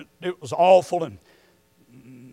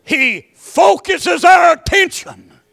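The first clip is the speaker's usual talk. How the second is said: slow, high, loud proclamation; preaching shout; 10 semitones higher, 8 dB louder, slower than usual. Real, not acted. Preacher proclaiming to the church in a slow shout. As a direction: call it out slowly from the pulpit.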